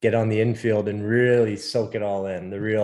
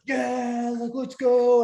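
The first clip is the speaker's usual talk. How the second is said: imitated high-pitched hyped holler; fired-up fan; 13 semitones higher, much slower than usual. Fan answering a yell from a passing car, imitated excited cheer. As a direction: cheer back like a rowdy race fan. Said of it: "the guy's like".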